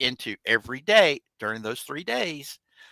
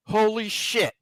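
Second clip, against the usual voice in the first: amazed; 7 semitones higher, slower than usual. Retold or imitated. Officer amazed.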